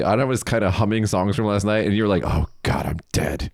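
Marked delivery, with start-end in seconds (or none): 2.2-3.5 low, drained mock groan; hungover groan. Host mimicking friend in the hotel lobby, low hungover groan. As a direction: imitate someone wrecked the morning after.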